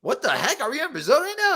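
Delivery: high, laughing what-the-heck; amused disbelief. Retold or imitated. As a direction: laugh it out, baffled. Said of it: "I was like".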